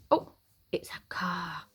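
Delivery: soft whisper, delighted discovery; whispered reveal. Pretend. Reader whispering the answer to the children.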